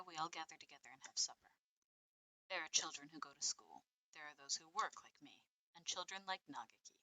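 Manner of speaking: quiet, slightly brisk story reading; diary narration. Acted. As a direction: read it softly and evenly, like a private journal.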